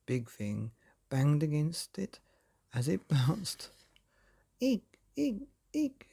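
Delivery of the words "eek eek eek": very high squeaky creature eeks; high-pitched creature squeak; tiny squeaks. A narrator's reading. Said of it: "it squeaked".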